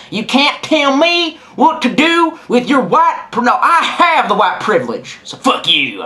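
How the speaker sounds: cocky high-pitched sneer, slow and drawn out; hammed-up defiance at the ranger; defiant retort. Talking back to the forest ranger, mock defiant and high. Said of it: "And I said".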